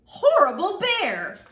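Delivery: loud, high indignant yell; stomping girl's shout. A narrator's reading. Girl stomping through the meadow, outraged shout. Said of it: "She stomped through the meadow".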